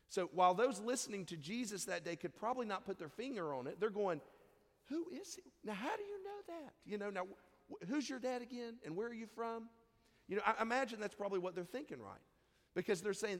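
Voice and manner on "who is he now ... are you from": high, astonished questions; amazed. Retold or imitated. Temple teachers amazed by boy Jesus, astonished high questions. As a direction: marvel at the boy, pitch lifted high.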